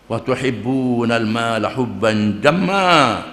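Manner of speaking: slow, drawn-out Arabic chant; measured melodic recitation; Quran recitation. Acted. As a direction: intone the verse like tilawah. Quran verse recited in sermon.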